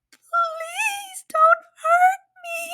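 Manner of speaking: high, slow, tearful squeak of a terrified mouse; crying mouse plea. Acted. Mouse pleading with the hungry lion, high and tearful. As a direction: beg for your life in a tiny crying voice. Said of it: "cried the mouse".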